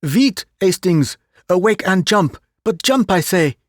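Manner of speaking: Poirot urging Hastings to jump off the train; urgent squeal. A narrator's reading. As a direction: rouse a dozing friend in a hurry. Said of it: "Poirot uttered a piercing squeal".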